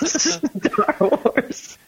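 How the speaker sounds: high-pitched, laughing through the words. Real, not acted. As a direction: crack up while trying to speak. Cracks up during the tier read.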